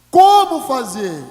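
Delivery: shouted, slow rhetorical question; loud slow question. Real, not acted. Preacher asking the church, loud and slow. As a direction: draw it out loud to the crowd. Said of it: "rhetorical question".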